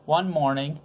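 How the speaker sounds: plain reading voice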